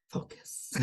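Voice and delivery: breathy note to refocus; whispered self-reminder. Pretend. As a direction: whisper it to yourself. Self-reminder after admitting tangents.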